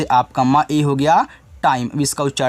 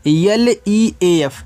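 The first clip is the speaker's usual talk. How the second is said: slow spelling out; 3 semitones higher, much slower than usual. Shown as a demonstration. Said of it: "तो इसको पढ़ते हैं बच्चों".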